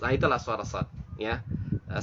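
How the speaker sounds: ordinary talk, nothing marked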